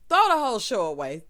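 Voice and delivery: loud exclaimed dismissal; loud, exasperated verdict; emphatic dismissal. Real, not acted. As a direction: toss it out loud, in one breath. Same line, then drawn out slower.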